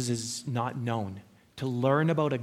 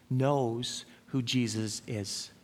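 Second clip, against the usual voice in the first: slow emphasis; much slower than usual. Real, not acted. Preacher hammering home the point.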